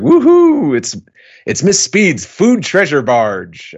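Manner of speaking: a rowdy cheer at the sight of food. Retold or imitated. Kaido's soldiers cheering the arriving food barge, loud whoop.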